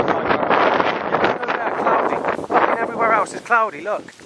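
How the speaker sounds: high, pointed complaint at the sky; irritated. Real, not acted. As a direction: jab at the clouds, annoyed. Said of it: "I'm literally getting pissed off".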